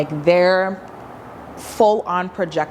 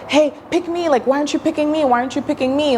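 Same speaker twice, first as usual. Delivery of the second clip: higher, whiny pick-me begging; mock needy plea; 7 semitones higher, much faster than usual. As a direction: play a desperate attention seeker. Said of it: "they're not frustrated".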